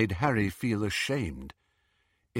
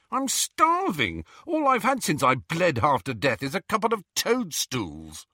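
high-pitched, angry grievance; snarling complaint; 7 semitones higher than usual. A narrator's reading. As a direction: rant hungrily at her, pitch raised. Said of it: "he snarled at Hermione".

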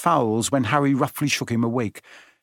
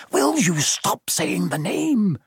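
slow, higher fearful hiss; 7 semitones higher, slower than usual. A narrator's reading. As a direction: hiss it, scared to be heard. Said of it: "Ron hissed".

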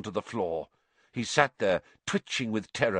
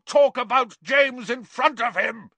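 high, loud, outraged shout; furious outburst; 6 dB louder than usual. A narrator's reading. Confronting the traitor for talking about James, furious. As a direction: shout it as an accusation.

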